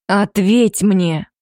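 slow, firm demand. A narrator's reading. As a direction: press hard for an answer. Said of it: "требовательно прозвучал голос оборотня".

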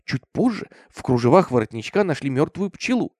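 usual speaking voice

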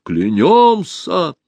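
drawn-out, high, loud vow; crowd oath. A narrator's reading. Crowd swearing an oath, high slow cry. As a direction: swear it loudly, stretching the word.

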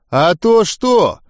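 snarling challenge. A narrator's reading. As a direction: draw out the challenge. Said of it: "оскалился Гоблин". (с вызовом, медленно и выше обычного)

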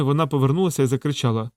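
plain reading voice

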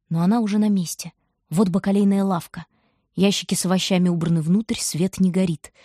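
plain reading voice

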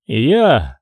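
short, firm claim; firm reply. A narrator's reading. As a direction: answer firmly, without raising the pitch. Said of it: "твердо ответил Пимен".

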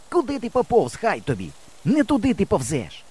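high-pitched annoyed calling; irritated shout. A narrator's reading. Boy scolds his wandering friend, irritated shout. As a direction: scold someone from a distance. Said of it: "роздратовано кричу я".

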